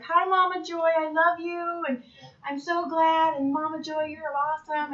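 high, loud gushing fan greeting. Retold or imitated. Young comedians greeting Mama Joy, high and gushing. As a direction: gush over Mama Joy like adoring fans. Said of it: "came up to me and was like".

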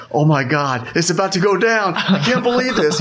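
high-pitched, climbing excitement; thrilled, rising retold reaction; excited disbelief. Acting his own excited reaction.

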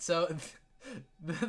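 ordinary talk, nothing marked

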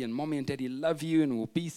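usual speaking voice

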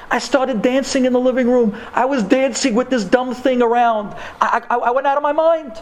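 high-pitched, mounting elation; breathless-with-joy storytelling; excited retelling. Retold or imitated. Retelling wild joy at pregnancy test.

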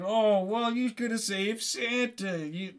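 high, whiny mock complaint; mocking imitation of a disappointed listener; objecting listener. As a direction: whine like an accusing audience member. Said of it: "You're saying".

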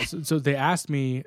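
ordinary delivery

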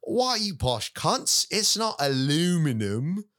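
high, drawn-out mock British accent; hammed-up British scolding, slow and high-pitched. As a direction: imitate an indignant Brit, stretching the words in a British accent. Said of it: "they make fun of us, and they say".

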